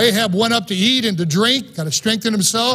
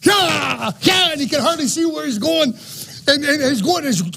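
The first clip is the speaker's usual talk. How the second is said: Ahab shouting in his chariot; 4 semitones higher than usual. Pretend. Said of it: "this is my little boy imagination".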